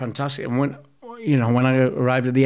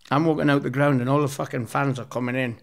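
ordinary talk, nothing marked

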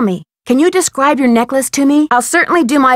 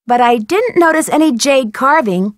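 normal talking voice

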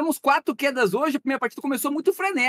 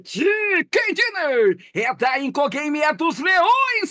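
victory shout; 5 semitones higher, much slower than usual. Real, not acted. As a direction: celebrate the win at full volume. Celebrating the victory.

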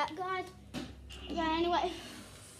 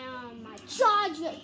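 ordinary delivery

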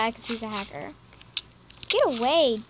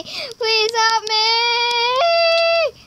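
long, sung, crying wail; wailing plea; 7 semitones higher, 6 dB louder, much slower than usual. Real, not acted. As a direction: stretch it out in a sobbing wail. Wailing while chased.

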